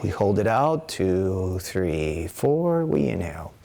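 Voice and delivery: calm instructor counting breaths; guided breathing count. Acted. Teacher guiding students' breathing, calm counting. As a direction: lead the class through the breath, count by count.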